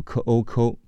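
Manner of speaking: 科 and 欧 blended into 抠; linked sounds. Shown as a demonstration. English teacher shows learners how Pacific meets Ocean, linking 科欧抠. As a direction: join 科 to 欧 so it becomes 抠. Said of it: "连起来读".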